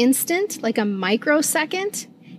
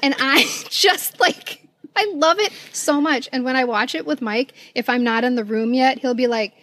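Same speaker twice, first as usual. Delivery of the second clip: usual speaking voice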